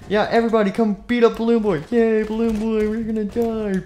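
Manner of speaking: loud, high, gleeful cheer; rally everyone with glee; hammed-up gleeful cheering; cheering crowd. Play-acting cheering crowd.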